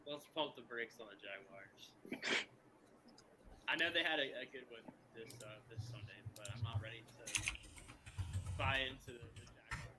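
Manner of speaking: faint, distant voice under hiss; mic turned way down, buried in hiss; quiet voice loud hiss. Real, not acted. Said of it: "Yo, I think your mic, like, disconnected 'cause you're, like, really quiet".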